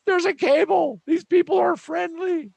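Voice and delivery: high, loud and gleeful alien discovering junk; friendly alien. Pretend. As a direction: squeak it out like a cheerful little alien who just found a cable. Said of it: "guy's gonna walk up and say".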